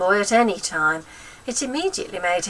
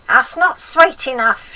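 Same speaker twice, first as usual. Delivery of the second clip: put-on high, loud cranky old woman; bossy wicked granny, pitched up and loud; grandma voice; 6 semitones higher, 9 dB louder, slower than usual. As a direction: nag the boy like a spiteful old grandma.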